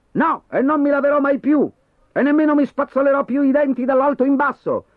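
high, loud cheeky refusal; defiant. Acted. Boy defying his mother at dinner, loud and high. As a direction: throw it back at her, rebellious.

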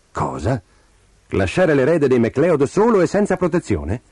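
scandalised storybook nursemaid; indignant. A narrator's reading. Old nursemaid rebuking her niece for the idea, indignant. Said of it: "la guardò indignata".

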